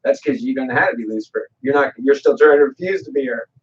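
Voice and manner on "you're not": usual speaking voice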